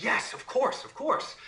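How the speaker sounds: high-pitched acted panic; terrified. Terrified girl agreeing to the imp's bargain, high and panicky. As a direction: agree in a high, frightened rush. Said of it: "she's so terrified that she says".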